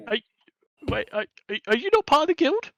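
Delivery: squeaky high panicked kobold voice; a terrified little thief babbling apologies; blubbering kobold. Acted. Kobold caught stealing carrots, panicked high squeak.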